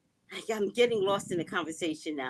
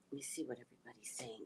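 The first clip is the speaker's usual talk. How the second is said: fast quiet whisper; whispered aside; 8 semitones lower, 19 dB quieter, faster than usual. Real, not acted. Reading live comments to herself, fast whisper. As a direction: mutter it under breath quickly.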